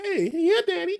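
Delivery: high, teasing flirtatious reply; mock flirty. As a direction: tease it like flirting back. Flirty reply to barber, then laughs.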